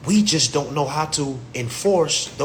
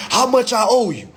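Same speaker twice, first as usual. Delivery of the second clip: higher, louder eager question; eager runner; 7 semitones higher, 6 dB louder than usual. Retold or imitated. Runner asking the plug what he owes, louder eager voice. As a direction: ask it like a keen runner. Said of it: "you say".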